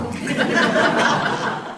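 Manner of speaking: a short airy laugh at the joke; breathy laugh. Real, not acted. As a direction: laugh under your breath, amused. Narrator laughs at own line.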